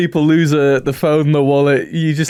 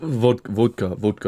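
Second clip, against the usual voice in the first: low mock Russian voice; practising a Russian accent; 5 semitones lower, 4 dB quieter than usual. Shown as a demonstration. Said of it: "how do you do a Russian accent".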